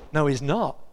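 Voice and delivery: ordinary delivery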